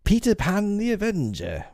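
high, ringing, dramatic proclamation; triumphant high-pitched declaration; Peter Pan. A narrator's reading. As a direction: announce yourself as a terrible avenger. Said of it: "came the terrible answer".

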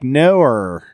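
"Noer" with an R tacked on the end; Australian "no". Shown as a demonstration. Showing Australian vowels, "Noer" drawn into a final R. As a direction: say "Noer" as a mock Australian no ending in R. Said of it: "I can't do Australia".

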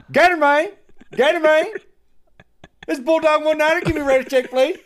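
loud, high radio call; over walkie talkie. Retold or imitated. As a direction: shout it into a trucker radio. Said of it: "this is what we heard every single time".

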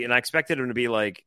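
ordinary talk

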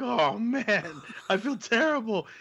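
high-pitched, dismayed groaning apology; acted remorse. Host apologizes to his friend's wife, acted high-pitched remorse. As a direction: replay feeling mortified.

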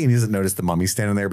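ordinary talk, nothing marked

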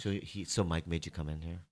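hushed, secretive voice; whispering. Real, not acted. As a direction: lean in and whisper the question. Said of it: "Why are we whispering?".

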